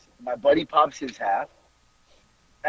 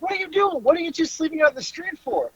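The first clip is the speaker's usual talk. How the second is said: indignant; 12 semitones higher, 4 dB quieter than usual. Retold or imitated. Buddy's brother to two drunks sleeping outside, indignant. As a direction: mimic an incredulous telling-off.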